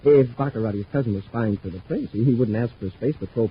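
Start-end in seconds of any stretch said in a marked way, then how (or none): none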